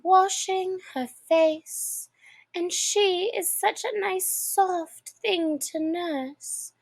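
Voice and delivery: put-on fond, dreamy little girl; lightly voiced Alice. A narrator's reading. Alice chats fondly to the mouse about Dinah.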